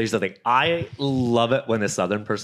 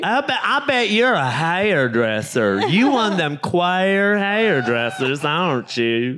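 mock Southern drawl; 4 semitones higher than usual. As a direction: needle him like a small-town bigot. Continuing Southern bigot impression.

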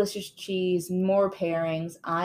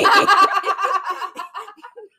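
loud, high burst of laughter; big laughing fit; 13 semitones higher, 10 dB louder than usual. Real, not acted. Co-host cracks up at the silly accent, loud laughter. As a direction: crack up loudly.

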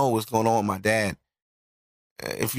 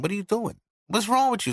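high-pitched disbelieving questions; retold incredulous protest; 7 semitones higher than usual. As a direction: replay the protest with voice jumping up. Retold protest at being choked.

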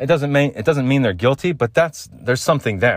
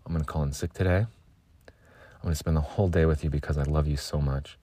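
much softer, lower doting coo; lovey-dovey murmur; 5 semitones lower, 9 dB quieter than usual. Retold or imitated. Lovesick morning self cooing to a partner, low and soft. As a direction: melt into it, tender and hushed. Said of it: "That's me thirty minutes after waking up".

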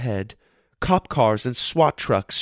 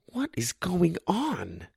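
alarmed; 6 semitones higher, 7 dB quieter, slower than usual. A narrator's reading. Josh alarmed by crunching pantry.

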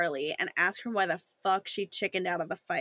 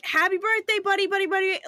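high mock birthday holler; imitating birthday yell; 10 semitones higher, 4 dB louder, faster than usual. Mocking Spencer's birthday call, high and loud. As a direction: parrot Spencer's phone yell. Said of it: "Why is he like".

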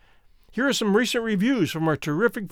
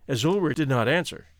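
ordinary delivery

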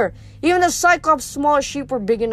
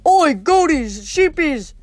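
loud, higher booming brute calling his flock; a dim giant bellowing for his sheep; dim giant cyclops; 5 semitones higher, 3 dB louder, much slower than usual. A narrator's reading. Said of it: "the Cyclops roared".

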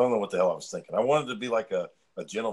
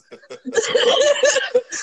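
loud high cackle; big laugh; 13 dB louder than usual. Real, not acted. Friend laughing at Daniel's forgotten jalapenos, loud cackle.

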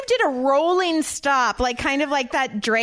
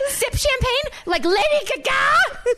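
higher, slowish New Jersey brag; Jersey accent; 12 semitones higher than usual. Pretend. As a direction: channel a reality-star Jersey accent. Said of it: "I love it when you do the Jersey accent".

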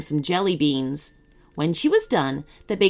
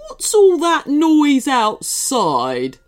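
loud, slow, high-pitched demand; imperious grumpy cupcake; 7 semitones higher, 6 dB louder, much slower than usual. A narrator's reading. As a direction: demand it rudely, stretching the words. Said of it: "demanded the cupcake".

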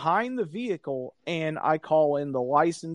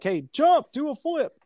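mocking jerk; 9 semitones higher than usual. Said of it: "an asshole that's just like".

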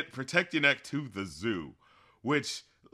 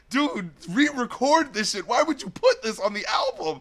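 high-pitched, incredulous plea; infuriated appeal, not shouted; exasperated; 7 semitones higher than usual. Acted. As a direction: complain as if begging the artist. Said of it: "you know what's infuriating".

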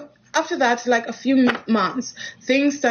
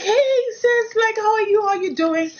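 high, bubbly hey sis, much higher pitch; gushing greeting; 12 semitones higher than usual. Retold or imitated. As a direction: greet her like an overexcited fan, high and bright. Acted cheerful greeting to Naomi.

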